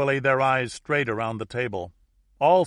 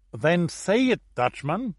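higher, insistent demand; demanding Lisbon entrepreneur; 7 semitones higher, 4 dB louder, slower than usual. A narrator's reading. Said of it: "demanded the entrepreneur from Lisbon".

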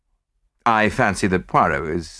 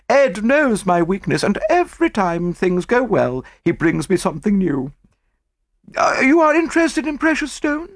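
much higher, breathless society matron; gushing rich lady; 13 semitones higher than usual. A narrator's reading. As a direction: voice of a showy, excitable stockbroker's wife.

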